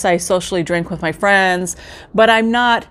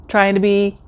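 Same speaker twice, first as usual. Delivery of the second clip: ordinary talk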